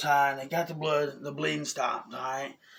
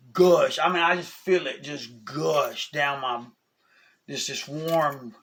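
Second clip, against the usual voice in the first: ordinary delivery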